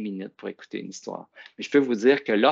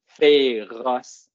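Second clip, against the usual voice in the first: Féroce, louder, in two clapped beats; clapping syllables; 6 dB louder than usual. Shown as a demonstration. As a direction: clap out Féroce as fé-roce. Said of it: "On le dit ça en tapant des mains". (Féroce détaché en deux syllabes, plus fort)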